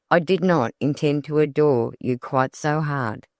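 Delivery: ordinary delivery, nothing marked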